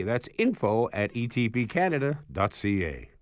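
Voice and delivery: lively, emphatic sponsor read; announcer-style pitch with lifted key words; radio ad read. Acted. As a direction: read it like a polished radio promo, numbers spelled out clearly. Reads a sponsor ad.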